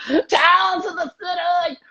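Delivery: put-on high, slow, strained Australian accent, half shouted; exaggerated Australian accent. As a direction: overdo the Australian accent as if constipated. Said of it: "really hard to do your accent".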